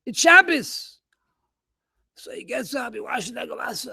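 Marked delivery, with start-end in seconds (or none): 0.0-1.0 wife objecting. Retold or imitated. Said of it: "She says".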